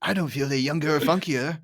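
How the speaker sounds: lightly voiced old tycoon; a hint of the cartoon boss; Burns. Co-host quoting the boss sipping beer, light impression. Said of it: "and Burns goes".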